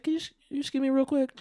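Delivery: high, quick, sweet excuse; polite excuse. Retold or imitated. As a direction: excuse yourself sweetly and fast. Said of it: "She said".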